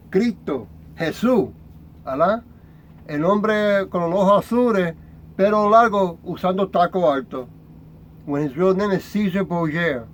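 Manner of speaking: ordinary delivery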